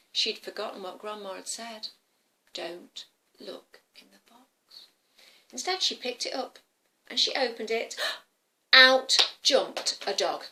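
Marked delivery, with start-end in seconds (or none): 2.5-4.9 slow, whispered warning; hushed warning. Acted. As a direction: whisper the forbidden rule slowly. Recalled warning from Grandma.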